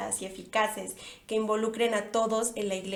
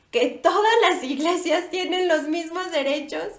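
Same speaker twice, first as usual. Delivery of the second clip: higher, louder mock president; imitating the president; 4 semitones higher, 4 dB louder than usual. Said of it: "y cito".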